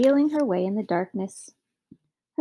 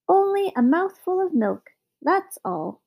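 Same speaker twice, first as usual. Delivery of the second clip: high little magic man; clear, thin voice; 9 semitones higher, 3 dB louder than usual. A narrator's reading. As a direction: call up in a thin, clear pipe. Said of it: "thin voice from below".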